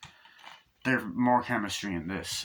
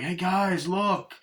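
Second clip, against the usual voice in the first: much higher, eager shout-out; excited call; 9 semitones higher, slower than usual. Acted. Winston Duke calling friends to the speedboat, excited high. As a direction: call the others over eagerly.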